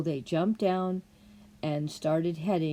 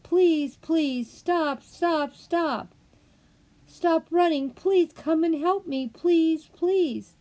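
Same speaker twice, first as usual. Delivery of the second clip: high, loud desperate yell for the rabbit to stop; 10 semitones higher, 7 dB louder, slower than usual. A narrator's reading. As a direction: call out after him, pleading, high and loud. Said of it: "She kept yelling".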